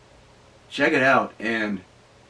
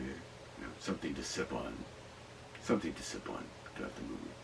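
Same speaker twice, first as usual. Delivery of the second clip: confiding aside; 8 semitones lower, 13 dB quieter than usual. Real, not acted. Confiding about sipping vodka.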